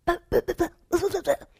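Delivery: high, loud stammering; panicked stammer. Acted. Salesman sputtering at the manager, panicked. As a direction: stutter in panic.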